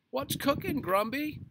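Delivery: high, loud heckle; teasing yell. A narrator's reading. Neighbour yelling out to running Mister Grumby, teasing. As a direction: yell a jokey question across the street. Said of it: "somebody yelled out".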